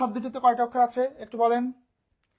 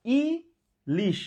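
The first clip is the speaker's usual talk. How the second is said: Ilish split slowly as "i - lish"; syllable by syllable; much slower than usual. Shown as a demonstration. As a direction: hold ই, pause, then give লিশ. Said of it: "উচ্চারণ করেন তো ধীরে ধীরে". (ইলিশ দুই ভাগে, থেমে থেমে)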